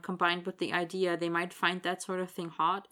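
ordinary delivery

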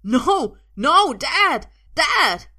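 high panicked yells; frantic, loud cries for rescue; shouting. A narrator's reading. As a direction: scream for your father's help. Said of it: "Arthur shouts".

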